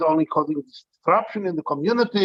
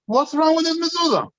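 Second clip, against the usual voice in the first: much higher, outraged question; indignant wife; 12 semitones higher than usual. Retold or imitated. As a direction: voice the offended wife snapping back. Wife retorts about her mezuzah.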